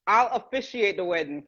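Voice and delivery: normal talking voice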